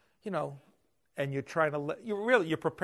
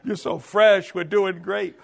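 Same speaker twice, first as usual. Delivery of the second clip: loud, bouncy imitation of the son; imitating the fresh son cheering beside his tired father; mock cheerful shout; 4 semitones higher, 11 dB louder than usual. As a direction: poke fun at his fresh energy. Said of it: "it's like".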